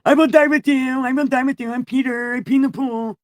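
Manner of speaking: high, loud mock pleading Peter; hammed-up insistent whine. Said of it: "Peter kept saying insistently".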